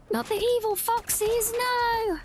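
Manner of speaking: sudden high alarmed no; alarmed protest. Real, not acted. Protesting at evil foxes in game.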